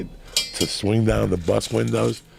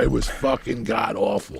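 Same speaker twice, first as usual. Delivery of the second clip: normal talking voice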